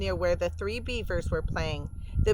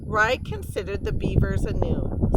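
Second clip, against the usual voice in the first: plain reading voice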